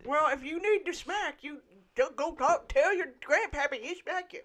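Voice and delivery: lightly voiced toothless old man. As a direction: gum the words like an old barkeep. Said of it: "It's my old man voice".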